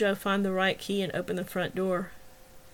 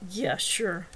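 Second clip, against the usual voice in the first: plain reading voice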